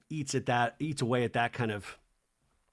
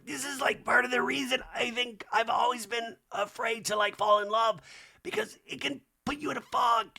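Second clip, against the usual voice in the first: losing composure; 11 semitones higher than usual. Retold or imitated. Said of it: "Heather's losing her".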